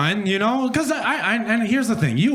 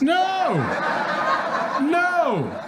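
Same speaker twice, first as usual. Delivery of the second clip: emphatic no; 10 semitones higher than usual. Real, not acted. Emphatic refusal, high pitch.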